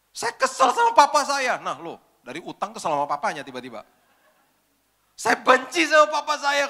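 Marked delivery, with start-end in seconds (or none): 0.1-1.6 high, loud, slow tearful wail; crying. Retold or imitated. Girl suddenly breaks down about her father, crying loudly. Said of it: "Tiba-tiba dia nangis". 5.2-6.7 high, loud, slow tearful wail; crying. Retold or imitated. Girl suddenly breaks down about her father, crying loudly. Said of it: "Tiba-tiba dia nangis".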